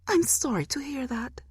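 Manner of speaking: high, otherworldly false sympathy; high eerie mock sympathy; demon mother's voice. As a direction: say it as a demon who isn't sorry.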